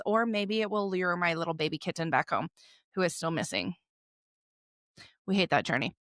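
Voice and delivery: normal talking voice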